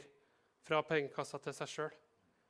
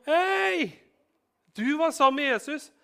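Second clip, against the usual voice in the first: high, loud accusing cry; accusing shout; 10 semitones higher, 9 dB louder than usual. Acted. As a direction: call him out across the courtyard. Servant accuses Peter in courtyard. (høyt, anklagende rop)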